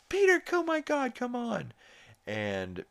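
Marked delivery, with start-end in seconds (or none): 0.1-1.7 much higher exasperated mock Lois; imitating Lois. Recapping the show, mimics Lois scolding Peter in a high squeal. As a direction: squeal it like a fed-up wife. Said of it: "she's like".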